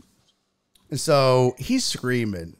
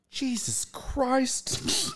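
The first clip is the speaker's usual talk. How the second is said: mock shocked sister; 11 semitones higher, 7 dB quieter, much slower than usual. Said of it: "And Laura goes".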